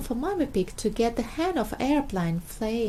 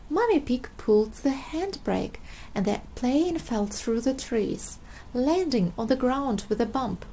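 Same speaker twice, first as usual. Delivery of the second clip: usual speaking voice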